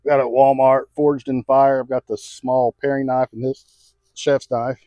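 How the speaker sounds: normal talking voice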